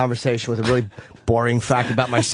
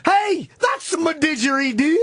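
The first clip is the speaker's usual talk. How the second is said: high, slow cartoon Australian accent, laughing; mock Australian accent with a whine; bad Aussie accent; 14 semitones higher, slower than usual. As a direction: ham up a silly Australian, pitched way up. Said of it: "You want some more Aussie accents?".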